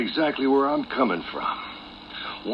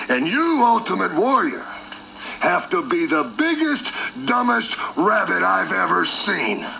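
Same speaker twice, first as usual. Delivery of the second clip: high, emphatic mockery; scornful outburst; 7 semitones higher than usual. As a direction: jump up in pitch and hurl the insult. Villain's punchline insult to his rival.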